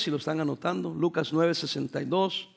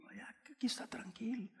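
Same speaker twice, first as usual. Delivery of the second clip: whisper; 5 semitones higher, 18 dB quieter than usual. Retold or imitated. Pastor acting out calm reply.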